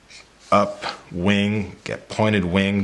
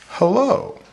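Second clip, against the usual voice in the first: high-pitched character hello; storybook greeting. Shown as a demonstration. Demonstrates punctuation from the book.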